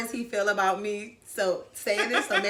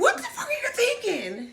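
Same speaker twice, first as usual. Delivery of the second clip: high, laughing mock parent outrage; mock scolding of a teenage daughter; outraged parent; 13 semitones higher than usual. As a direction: play the horrified mom. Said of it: "you'd be like".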